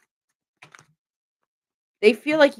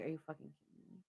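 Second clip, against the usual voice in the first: fast, much softer mutter of disbelief; 5 semitones lower, 25 dB quieter, much faster than usual. Retold or imitated. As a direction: mutter it under your breath, quick and stunned. Listener's reaction voiced.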